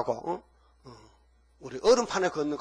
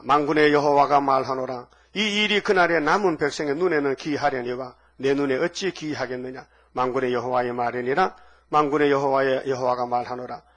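solemn scripture reading; 4 dB louder than usual. Acted. Reads scripture from the pulpit.

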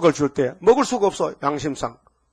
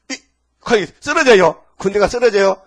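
excited telling; 5 semitones higher, 9 dB louder than usual. A narrator's reading. Soldiers fall before Jesus.

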